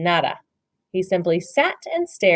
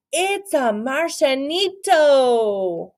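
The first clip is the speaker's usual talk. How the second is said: slow, higher wonder; amazed; 7 semitones higher, much slower than usual. Acted. Scipito marvels at the Martian, amazed.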